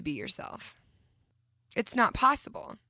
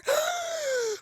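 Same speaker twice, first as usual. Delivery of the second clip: mock gasp. Mom calling son for hugs.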